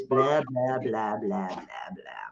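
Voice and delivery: fading, dismissive blah blah; bored blah blah fading away; trailing blah blah. Acted. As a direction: let the blahs trail off to nothing.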